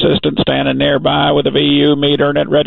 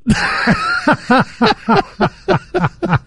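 laugh. Real, not acted. Laughs at own joke.

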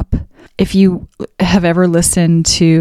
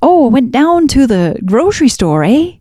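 hammed-up Canadian accent, higher and slowed; playfully mock the Canadian accent with a big "eh"; 5 semitones higher than usual. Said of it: "what I consider to be a Canadian accent is more of".

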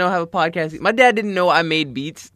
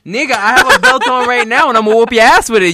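mock threat; 7 semitones higher, 8 dB louder than usual. Threatening a friend with belt.

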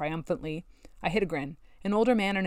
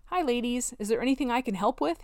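falsely innocent cheerful question; 7 semitones higher than usual. A narrator's reading. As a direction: play it innocent. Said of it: "he asked innocently".

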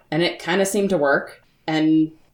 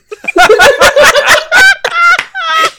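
big high burst of laughter; loud laughter; 12 dB louder than usual. Real, not acted. Player cracking up loudly at the table, laughing hard.

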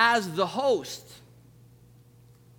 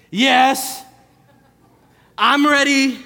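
much louder, curt "Yes, I'm ready" with a clipped "Yes"; snippy; 4 semitones higher, 10 dB louder, much slower than usual. Retold or imitated. How parents hear the kid's reply, loud and snippy. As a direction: snap "Yes, I'm ready" back with an attitude. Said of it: "But your parents heard".

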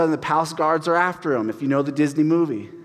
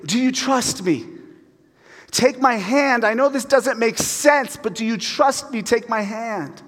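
higher, earnest plea that slows at the end; a gentle higher voice of God inviting trust; pleading invitation; 6 semitones higher than usual. Retold or imitated. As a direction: coax someone to take your hand. Voicing God reaching out.

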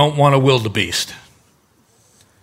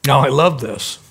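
ordinary talk

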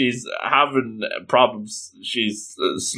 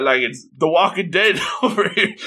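ordinary delivery, nothing marked

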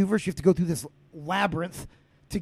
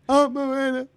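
ordinary talk, nothing marked

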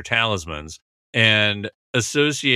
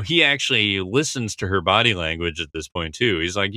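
ordinary talk, nothing marked

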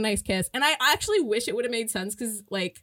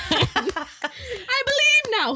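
high, drawn-out wail through laughter; laughing mock protest; 12 semitones higher, much slower than usual. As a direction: wail it slowly while cracking up. Laughing reprise of Frances's protest.

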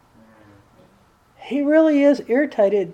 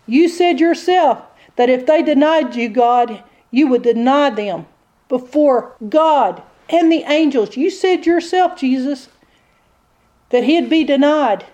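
devil pressing his case, higher-pitched; accusing devil; 4 semitones higher, 3 dB louder than usual. Acted. Devil presents evidence to God against Peter, accusing. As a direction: argue insistently as the accusing devil. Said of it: "The devil presents the evidence".

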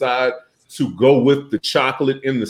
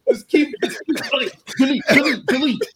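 laughing; 10 semitones higher than usual. Real, not acted. Laughing while bleeping out words.

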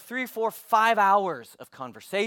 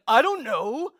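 higher, louder mock retort with rising end; throwing attitude; 5 semitones higher, 5 dB louder, slower than usual. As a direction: imitate someone who couldn't care less. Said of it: "He was throwing attitude at me".

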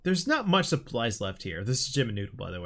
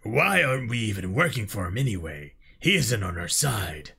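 low, doubting alien soldier; a lowered, questioning alien warrior; deep elite voice; 6 semitones lower than usual. Acted. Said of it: "For elite voices".

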